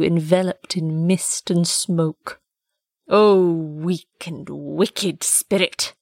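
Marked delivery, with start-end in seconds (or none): 3.1-5.9 booming archangel voice. Acted. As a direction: pronounce judgment, very slowly. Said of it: "said the archangel".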